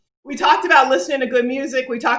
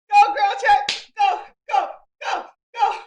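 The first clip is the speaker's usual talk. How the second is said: excited cheer; 9 semitones higher than usual. Real, not acted. Cheering during dance.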